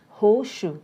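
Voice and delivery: Horseshoe said hoshu, the s turned to sh; one smooth hoshu; connected speech. Shown as a demonstration. Teacher repeating the linked horseshoe. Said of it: "The sound saw changes into shaw sound".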